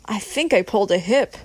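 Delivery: usual speaking voice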